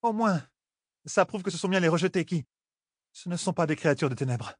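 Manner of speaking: grim high voice. Acted. Elaine grimly to the group. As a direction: sombre realisation. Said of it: "dit Elaine d'un ton sinistre".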